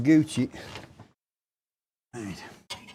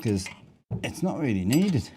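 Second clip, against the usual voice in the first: normal talking voice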